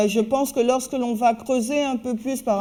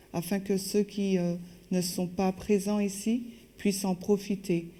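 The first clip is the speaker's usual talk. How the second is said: soft, low-key spoken prayer; 3 semitones lower, 7 dB quieter than usual. Real, not acted. Interpreter asking God to assist Alison and bless listeners, prayerful. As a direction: pray quietly and reverently, in measured phrases.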